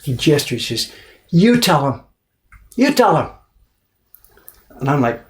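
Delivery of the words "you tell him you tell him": high, loud insistent urging. Retold or imitated. Papermaker urging his guest, high and insistent. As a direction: push the guest to speak up.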